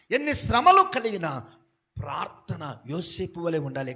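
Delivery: ordinary talk, nothing marked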